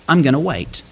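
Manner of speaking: ordinary delivery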